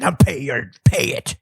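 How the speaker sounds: put-on menacing ruler's voice; mock royal threat, slowing at the end; mock tax collector. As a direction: threaten a peasant as a hammed-up tax-collecting king. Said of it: "I'd be the one going around getting the taxes".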